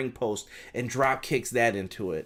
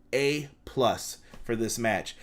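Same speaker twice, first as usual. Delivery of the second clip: usual speaking voice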